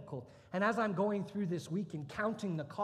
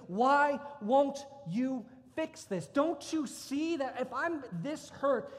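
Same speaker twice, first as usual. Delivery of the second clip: building from firm to a loud, high anguished cry; furious plea rising to a loud high cry; rage; 7 semitones higher, 5 dB louder than usual. Retold or imitated. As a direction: let the anger climb until you're yelling at heaven. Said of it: "was rage".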